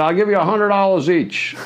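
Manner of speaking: high put-on boss voice; imitating Michael. Michael offering the day's pay, high mock voice. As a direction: pitch it up like the showman boss. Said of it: "He said".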